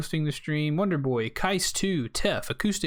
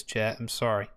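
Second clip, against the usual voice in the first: ordinary talk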